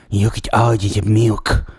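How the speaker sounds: slow, put-on milk guy voice. Co-host auditioning as milk guy, slow put-on voice. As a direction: draw it out in a goofy put-on voice. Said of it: "I could be the milk guy".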